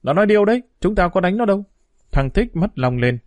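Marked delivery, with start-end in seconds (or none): 0.0-1.6 indignant protest. A narrator's reading. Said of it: "cãi luôn miệng".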